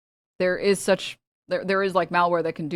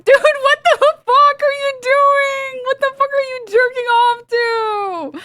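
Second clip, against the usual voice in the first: much higher, slow, stretched-out mock yell; hammed-up horrified shout, high and drawn out; mock outraged shout; 6 dB louder, slower than usual. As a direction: bellow it like a horrified onlooker. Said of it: "everyone's like".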